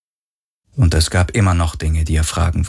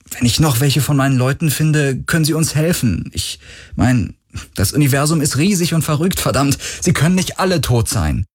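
higher, quick, hopeful plea; urgent pleading; 5 semitones higher than usual. Acted. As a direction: push hard, almost desperate hope. Galen urgently pleads for help.

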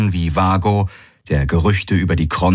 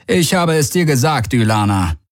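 man's voice; 7 semitones higher than usual. Acted. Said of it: "sagte der Mann neben ihr".

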